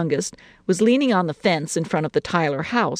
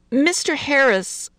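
slow, higher, measured naming; careful; 10 semitones higher, slower than usual. A narrator's reading. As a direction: pick the words out with care. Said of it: "Missus Harris said carefully".

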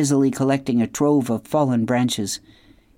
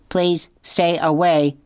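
robot voice; 4 semitones higher, slower than usual. A narrator's reading. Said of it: "said the robot".

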